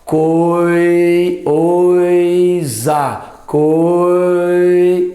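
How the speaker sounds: "Coi-" stretched in one breath, oi glided together; drawn-out coi. Shown as a demonstration. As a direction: sound out "coi-" slowly as one syllable. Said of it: "a mesma emissão de ar".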